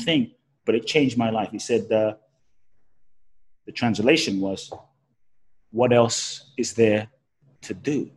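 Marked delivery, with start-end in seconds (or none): 5.7-8.1 slow, deliberate quote of a wise man; slow, weighty repeat of the beggar's words; quoting the beggar. Retold or imitated. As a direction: let each word land slowly. Quoting the blind beggar's answer.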